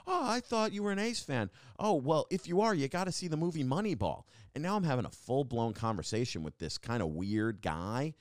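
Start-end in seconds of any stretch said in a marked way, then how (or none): 0.1-4.2 imitating stranger. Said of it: "He was like".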